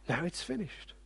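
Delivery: a fading, whispered echo of finished; near whisper. Shown as a demonstration. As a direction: breathe it almost silent.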